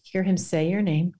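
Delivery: usual speaking voice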